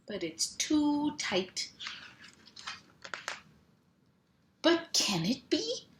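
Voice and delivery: lively picture-book read-aloud with sound words; reading to children. Acted. Mom reading a truck book to kids, playful. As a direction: bounce the rhythm for little listeners.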